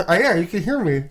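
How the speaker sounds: talking around a pen; pen in teeth. Real, not acted. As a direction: speak with a pen clenched. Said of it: "I'll put my pen in my mouth".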